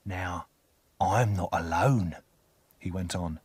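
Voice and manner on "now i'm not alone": menacing convict. A narrator's reading. Convict continues threat, lower.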